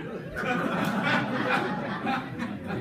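a high, breathy burst of laughing; real laughter. Real, not acted. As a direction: laugh loudly at the image. Laughter after angry remark.

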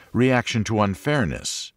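normal talking voice

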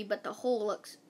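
plain reading voice

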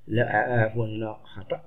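ordinary delivery

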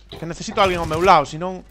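ordinary talk

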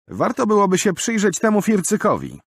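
high, loud knowing remark; shrewd young man. Acted. Roland pointing the crowd at the suspect, knowing. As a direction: point out the dandy slyly.

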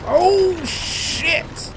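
high, loud yell of alarm; real shocked outburst, voice shooting up; startled scream. Real, not acted. Player startled by the game's attacker, panicked scream.

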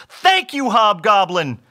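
high, loud thanks, dropping at the end; big, excited gratitude; delighted cry. A narrator's reading. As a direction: call out thanks in triumph. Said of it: "cried Bob".

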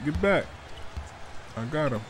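normal talking voice

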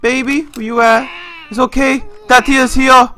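put-on high, loud baby-talk comforting; mock mommy cooing. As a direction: coo loudly at a crying baby. Coos to crying baby in game.

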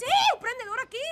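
loud, high, exasperated yes; exasperated shout. Acted. Daughter shouting the lost brooch at her mother, exasperated.

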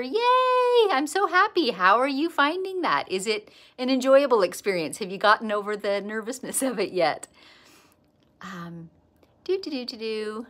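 ordinary talk

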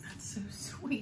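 soft breathy whisper, slow; whispered aside while reading. Real, not acted. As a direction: hush it like a secret. Whispered reaction to handmade note.

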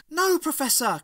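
high, loud cry of protest; acted high-pitched desperate yell. A narrator's reading. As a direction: shout out in alarm to stop him. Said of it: "cried Ace".

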